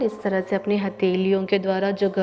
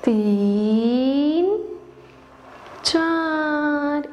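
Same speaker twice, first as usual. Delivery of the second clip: drawn-out count; 5 semitones higher, much slower than usual. Shown as a demonstration. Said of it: "गर्दन को सांस लेते हुए".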